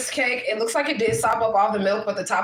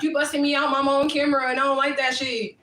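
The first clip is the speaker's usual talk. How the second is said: higher-pitched mock complaint; imitating daughter; 5 semitones higher than usual. Mom mimics daughter objecting to nicknames on camera, mock protest. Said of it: "she gonna be like".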